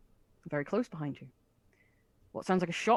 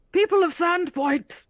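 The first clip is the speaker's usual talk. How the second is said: booming proclamation, much higher and louder; huge booming voice; 12 semitones higher, 7 dB louder, slower than usual. Acted. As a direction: boom it out to the whole town. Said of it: "huge booming voice that he was not using earlier".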